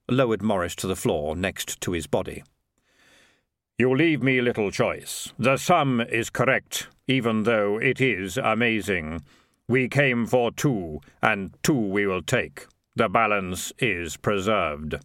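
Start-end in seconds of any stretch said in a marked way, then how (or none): 3.8-15.0 slow, measured, hollow delivery of Death; Death, slow and grave. Acted. Death tells Morris the sum is correct, slow and grave. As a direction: weigh every word like the reaper.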